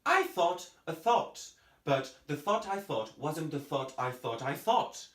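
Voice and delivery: "thought" with a clear th, tongue to the teeth, blowing air; every th pronounced. Shown as a demonstration. Teacher demonstrates a tongue twister, clearly blown th in every "thought". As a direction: blow air through the th of each "thought". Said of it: "I did pronounce every th sound correctly".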